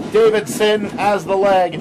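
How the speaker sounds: normal talking voice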